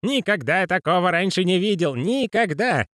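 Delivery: put-on high, excited old clerk's voice; jubilant old man. A narrator's reading. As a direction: shout the news with glee. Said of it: "ликовал мистер Грош". (ликующий высокий голос старика)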